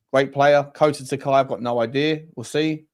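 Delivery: usual speaking voice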